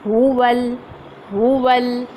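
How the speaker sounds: हुवल with lips rounded on the हु and the व. Shown as a demonstration. Teacher showing rounded lips on हुवल. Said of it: "होंठ round".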